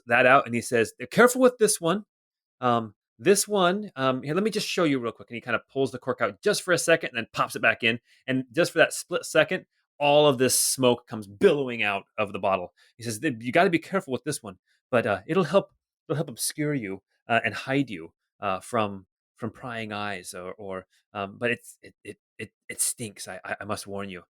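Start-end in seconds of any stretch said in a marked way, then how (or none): 1.1-5.3 lightly voiced merchant, nervous laugh then hurried sales patter; a hint of a flustered shopkeeper covering for the joke. Merchant selling to the party, lightly voiced and flustered. Merchant shows smoke bottle. 13.2-24.2 lightly voiced merchant dropping to a hushed warning; a hint of a merchant confiding a secret; cautious merchant. Merchant warning the buyers about the stinking bottle, hushed.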